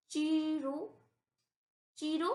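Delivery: teacher models चीरो with a clear ch, then repeats it; careful syllables. Shown as a demonstration. Teacher drills students on saying it, clear ch. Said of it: "इसको भी हम पढ़ेंगे ची".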